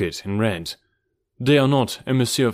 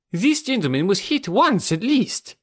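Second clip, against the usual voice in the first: high-pitched character voice for an insistent doctor; fussy doctor; 10 semitones higher than usual. A narrator's reading. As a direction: interject in a high, piqued doctor's voice.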